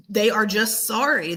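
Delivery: ordinary talk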